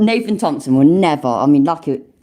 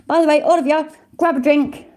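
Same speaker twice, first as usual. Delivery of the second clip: much higher, giddy party call; excited birthday cheer; 10 semitones higher than usual. Real, not acted. Streamer rallying his chat to drink, excited and high. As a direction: whoop it up for the chat, tipsy and celebrating.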